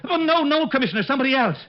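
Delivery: desperate to undo the insult; frantic backpedal. Acted.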